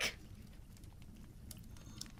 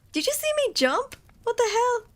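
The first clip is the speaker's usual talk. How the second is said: excited disbelief; 10 semitones higher than usual. Real, not acted. Recounting own jump to chat.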